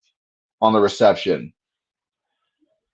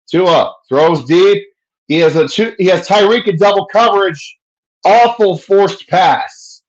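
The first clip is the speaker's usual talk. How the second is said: higher, slow, building live call; excited play-by-play; 6 semitones higher, 4 dB louder than usual. Acted.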